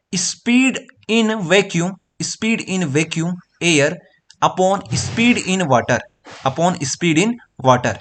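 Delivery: "speed in vacuum upon speed in water" drawn out very slowly; slow dictation. Shown as a demonstration. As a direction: dictate the formula slowly word by word. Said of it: "तो आप लोग refractive index को क्या बोल सकते हो?".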